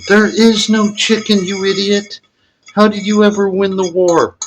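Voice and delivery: high-pitched mock exasperation, talking down; exasperated local. Mocking the confused foreigner who asks where the chicken is, in a high exasperated voice. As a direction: squeak it out like a fed-up know-it-all.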